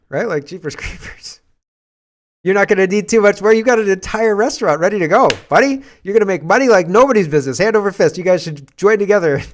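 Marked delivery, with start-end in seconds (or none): 2.4-9.4 higher and louder, selling it like a hype man; over-the-top mock encouragement; mock hype pep talk. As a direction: cheer him on with fake enthusiasm, buddy.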